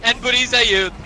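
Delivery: building esports caster hype toward the end; excited play-by-play. Acted. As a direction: sell the final wipe as it happens. Caster calls the final fight.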